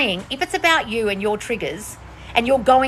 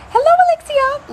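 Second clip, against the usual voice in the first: much higher, louder hello; sing-song greeting; 7 dB louder than usual. Real, not acted. Host greets a viewer in a high sing-song. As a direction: greet them like a cheery hostess.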